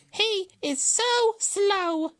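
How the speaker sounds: very high, loud, drawn-out words; amused hare. A narrator's reading. Hare gloats to himself about Tortoise, high and drawn-out. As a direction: gloat slowly in a squeaky hare voice. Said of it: "he laughed to himself".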